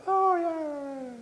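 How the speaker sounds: high, drawn-out party whoop; long celebratory cry in a high voice; noisy Mexicans. Retold or imitated. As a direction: imitate a rowdy family cheering from afar. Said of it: "these noisy Mexicans".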